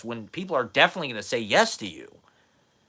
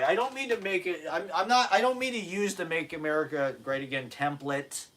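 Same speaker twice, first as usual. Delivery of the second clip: normal talking voice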